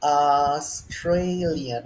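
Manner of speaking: Australian very slow; demonstrated word. Shown as a demonstration. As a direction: stretch Australian out syllable by syllable. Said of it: "it should be".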